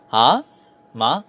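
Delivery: HA and MA rising from low, like a question; "ha? ma?" each sliding upward; surprise intonation. Shown as a demonstration. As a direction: lift "ha" and "ma" as if surprised. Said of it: "Kasi parang surprise ka".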